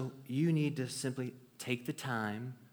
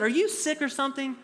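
higher, laughing mock-worried mom; 13 semitones higher, 6 dB louder than usual. Mom on the phone asking her son if he is sick, laughing. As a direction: play the surprised mother half laughing.

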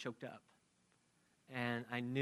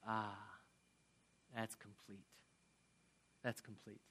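soft, quiet, contented sigh of satisfaction; contented Father; 5 semitones lower, 13 dB quieter than usual. Pretend. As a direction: let it settle, pleased and at peace. God voiced contentedly watching.

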